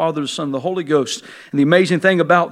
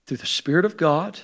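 usual speaking voice

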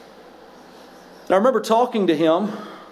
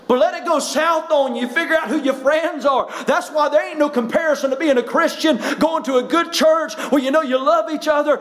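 raised, fervent pulpit voice, pitched up high; full-force preaching; 8 semitones higher than usual. Acted. Pastor to his congregation, fervent full-force preaching. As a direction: preach it to the back pews.

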